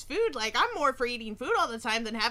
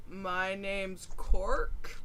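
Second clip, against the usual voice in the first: lower, very slow, lightly voiced grump; sullen goblin; 5 semitones lower, 4 dB quieter, much slower than usual. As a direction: drag it out like a sulky guard. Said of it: "and he's like".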